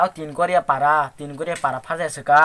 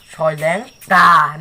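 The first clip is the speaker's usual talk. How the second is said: "soal yang ta" very slow, "ta" loud and long; "soal yang ta" syllable by syllable, falling on a long "ta"; drawn-out name; 7 dB louder, much slower than usual. Shown as a demonstration. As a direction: stretch "soal yang ta", landing hard on "ta". Sounds out the village name's origin.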